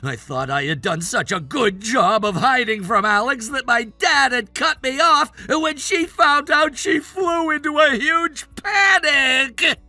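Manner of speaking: indignant outburst. Acted. Man blaming a rival for ruining his plan, indignant outburst. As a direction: rant like your plan was wrecked. Acted quarrel, son blames the accuser.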